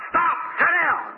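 high, drawn-out imitation shout; mock heckler's command from the crowd; retold shouted order. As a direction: call it out high and slow, imitating a bystander. Acted bystander ordering him to stop.

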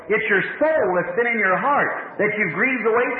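ordinary talk

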